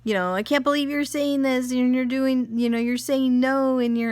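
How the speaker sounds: high, whiny mock protest; protesting kids. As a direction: imitate kids aghast at Mom. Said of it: "they're looking at me like".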